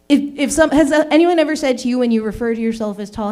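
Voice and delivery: ordinary talk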